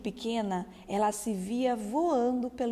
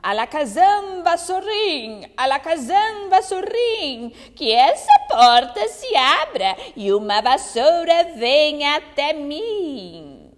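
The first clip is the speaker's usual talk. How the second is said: loud, chanting witch incantation; witch's spell; 5 semitones higher, 10 dB louder than usual. Acted. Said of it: "aquela bruxa dizendo".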